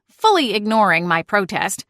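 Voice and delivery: plain reading voice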